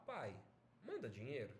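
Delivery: low, gentle plea; request. Shown as a demonstration. Teacher showing the same phrase as a request, gentle. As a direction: ask softly, pausing after the first word. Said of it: "é um pedido".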